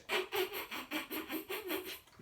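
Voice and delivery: high wheezy burst of laughter. Real, not acted. Host retelling Mister T, high laugh. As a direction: let out a high giggle.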